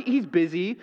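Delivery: ordinary talk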